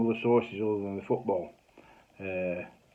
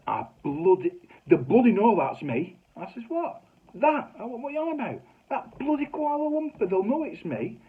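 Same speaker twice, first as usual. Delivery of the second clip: mock daughter; 13 semitones higher, 6 dB louder than usual. Daughter Claire voiced protesting.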